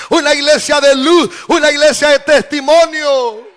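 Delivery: loud, rising preacher's call; full-force pulpit preaching; fiery preaching. Acted. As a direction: preach it out to the congregation.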